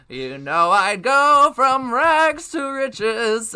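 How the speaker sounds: high, slow silly bird voice; birds' voice. Retold or imitated. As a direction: drag it out in a high cartoon voice. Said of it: "they're like".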